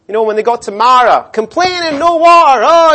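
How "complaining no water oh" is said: whiny complaining. Retold or imitated. Mocking the grumbling Israelites.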